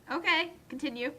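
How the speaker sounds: ordinary talk, nothing marked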